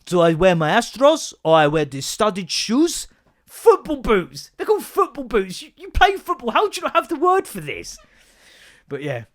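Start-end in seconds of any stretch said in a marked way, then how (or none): 0.0-3.1 slow, louder, laboured question; mocking imitation. Dad mimics son's boot question, mockingly laboured. 3.5-8.0 high-pitched incredulous rant; exasperated disbelief. Acted. Dad corrects footballer son's vocabulary, exasperated.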